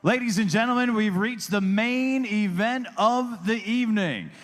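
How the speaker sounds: booming boxing announcer read; ring announcer. Acted. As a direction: announce it to the arena.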